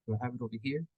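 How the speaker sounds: ordinary talk, nothing marked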